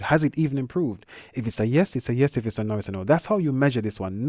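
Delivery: normal talking voice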